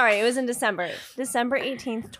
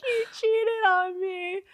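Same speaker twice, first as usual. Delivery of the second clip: imitating crying; 9 semitones higher, slower than usual. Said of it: "crying in the lunch line".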